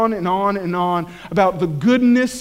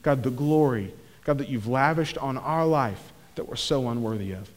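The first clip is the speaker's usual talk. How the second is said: low, quiet prayer voice; hushed, low-pitched prayer winding down to amen; closing prayer; 10 semitones lower, 10 dB quieter than usual. Real, not acted. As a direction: pray softly and reverently, well under full voice.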